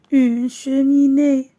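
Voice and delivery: slow, loud and clear for the class; slow model reading. Shown as a demonstration. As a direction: spell it out slowly to pupils. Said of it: "teacher models word slowly". (lecture lente et forte pour la classe)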